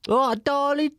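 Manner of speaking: high, loud Essex geezer greeting; high Essex impression. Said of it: "But he's more Essex-y".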